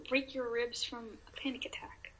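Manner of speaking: hushed disbelieving whisper; real whispered aside. Real, not acted. Incredulous aside while reading.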